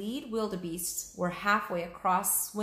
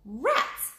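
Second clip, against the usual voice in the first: annoyed Jack; 6 semitones higher, 5 dB louder than usual. Acted. Jack's glasses muddy.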